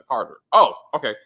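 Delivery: normal talking voice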